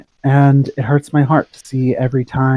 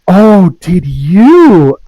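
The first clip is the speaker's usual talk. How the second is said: slow, higher and louder, gleeful; scandalized glee; 7 semitones higher, 11 dB louder, much slower than usual. Acted. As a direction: savour the gossip with a wicked grin. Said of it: "grin scandalously".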